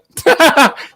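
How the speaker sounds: short high mocking laugh; scoffing laugh. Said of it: "I went".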